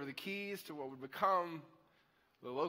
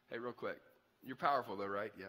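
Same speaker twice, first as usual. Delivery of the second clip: put-on softer, lower, sly kid asking a favour; lightly voiced sneaky kid at the back; cheeky kid at the back of the class; 7 semitones lower, 4 dB quieter than usual. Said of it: "that kid in the back of the class that's like".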